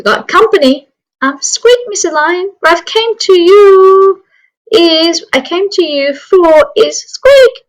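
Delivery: high, nervous squeaky pleading; timid mouse. Acted. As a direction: squeak up shyly at the lion. Timid mouse waking the lion.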